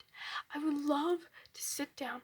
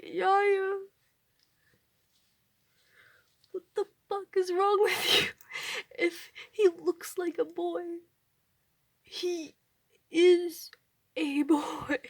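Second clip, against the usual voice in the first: loud, higher, word-by-word retort; exasperated outrage; 4 semitones higher, 6 dB louder, slower than usual. Retold or imitated. Retelling a confrontation with grandmother over misgendering, outraged. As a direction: act out scolding a grandmother, hammering each word.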